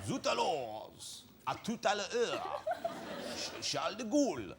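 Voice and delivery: put-on comedy French accent, laughing through it; jokey French accent impression; hammed-up French accent. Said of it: "He did all that, right?".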